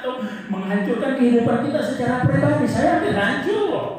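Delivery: high, loud preaching cry; impassioned preacher pleading loudly; fiery preaching. Acted. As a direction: drive the warning home from the pulpit. Preacher at full force.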